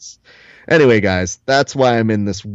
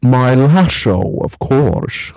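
slow, drawn-out Scottish Connery brogue; smug Scottish purr, very slow; Sean Connery voice; 2 semitones higher, much slower than usual. Retold or imitated. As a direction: savour each word like Connery. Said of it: "the Sean Connery impression that I do".

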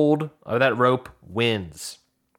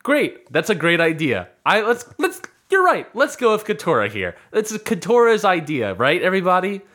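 higher-pitched, over-keen agreeing; mock eager agreement; 5 semitones higher than usual. Retelling Drew rallying everyone behind Katora's idea, mock eager. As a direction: jump on the idea and sell it to the room.

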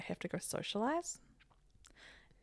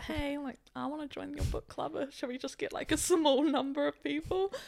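tentative request; 6 semitones higher than usual. Acted. Rehearsing a message to the book club girl, tentative request. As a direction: float the idea gently, pitched up.

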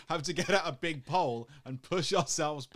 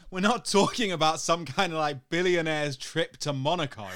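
loud, raised protest breaking into laughter; laughing indignation; 4 semitones higher, 7 dB louder than usual. Real, not acted. Defending canal boat holidays against teasing, indignant and laughing. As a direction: argue it hotly, louder and higher, half laughing.

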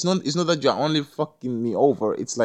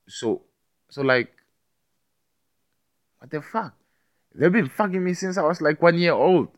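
worked-up retort; 7 semitones higher than usual. Retold or imitated. Mocking retort to critics.

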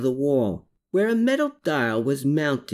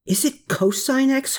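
screeched question; 13 semitones higher than usual. A narrator's reading. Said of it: "Herman screeched".

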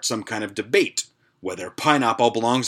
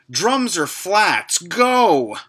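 mock game-show challenge; 7 semitones higher, 4 dB louder, much slower than usual. Mock reading a Facebook debate post.

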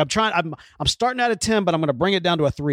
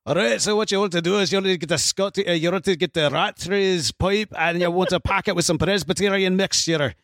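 higher-pitched attempt at a Scottish accent; 4 semitones higher than usual. Co-host repeats pipe advice to listener, in Scottish accent. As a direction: put on a Scottish accent and run with it. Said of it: "compliment you on a lot of your accent".

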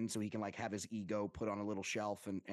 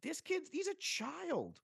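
much higher and slow, in mock amazement; stunned disbelief; slower than usual. About Scotty Scheffler's age, a hammed-up high stunned exclamation. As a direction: gasp it out as if you can't believe it. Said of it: "you're like".

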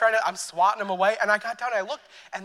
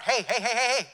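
insistent, mildly raised attention-getting call; rapid-fire heys, as if stopping someone, not shouted; calling out loudly; 4 semitones higher than usual. Acted. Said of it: "is gonna be loud".

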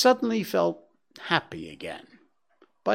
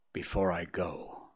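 gently; 6 semitones lower, 8 dB quieter than usual. A narrator's reading. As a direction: say it tenderly, softly. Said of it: "gently added".

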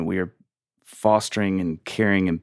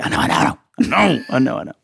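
sheepish admission; 5 semitones higher, 3 dB louder than usual. Retold or imitated. Answering Jesus' rebuke.

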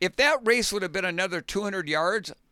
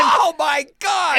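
loud, high, drawn-out exclamation; slow, shouted amazement; real astonishment; 8 semitones higher, 9 dB louder, slower than usual. Real, not acted. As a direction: react in thrilled disbelief. Listener thrilled hearing the race retold.

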